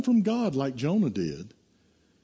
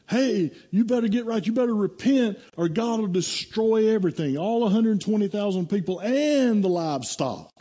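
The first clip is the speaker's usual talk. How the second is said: raised, urgent prophet's warning; preaching warning; 8 semitones higher than usual. Retold or imitated. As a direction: call the city to repent, pitched up. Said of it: "and he preaches".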